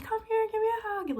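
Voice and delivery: very high, bubbly voice calling her in; high squealed come-here; high sweet invitation. Retold or imitated. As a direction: imitate a gushing host asking for a hug. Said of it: "she's like".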